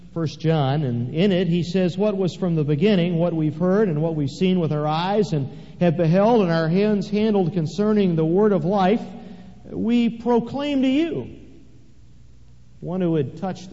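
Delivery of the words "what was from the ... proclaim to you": proclaiming scripture. Acted. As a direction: build it up and proclaim. Quoting John, preaching loudly.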